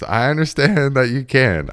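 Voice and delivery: high, strained-patience retort; mock exasperation. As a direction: imitate a friend pushed past his patience. Smaller dude argues back, exasperated.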